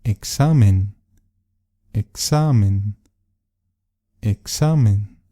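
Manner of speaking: "Examen" with the middle syllable XA drawn out and hit hard; exaggerated stress. Shown as a demonstration. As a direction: punch the XA in "Examen", slowly. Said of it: "voy a exagerar un poco el sonido". (acento exagerado en XA de "Examen")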